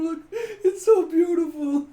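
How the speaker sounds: high, loud, laughing wail; hammed-up weeping through giggles; mock sobbing. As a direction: blubber slowly over something beautiful.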